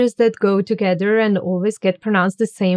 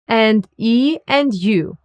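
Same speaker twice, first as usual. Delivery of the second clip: ordinary talk, nothing marked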